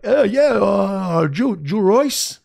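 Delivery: high, loud, drawn-out stammer; slow, high-pitched halting question; imitated hesitant stranger. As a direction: play an awkward guy asking who someone is. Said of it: "And he's like".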